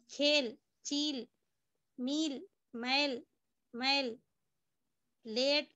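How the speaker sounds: each word said slowly and clearly with a pause after it; slow word drill. Shown as a demonstration. Teacher reading a word list slowly to students. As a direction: read the list word by word, spaced out for the class. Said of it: "teacher reads word list".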